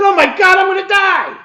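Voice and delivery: joking to the nurse he'll die, shrieking; mock panic. Mock panicked shriek. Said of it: "I'm kidding anyway".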